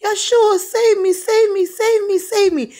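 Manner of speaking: very high, loud frantic pleading; imitated panicked screams to be saved; screaming for help. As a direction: scream it over and over in desperation. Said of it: "he was screaming this loud over the phone".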